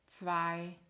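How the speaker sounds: ordinary talk, nothing marked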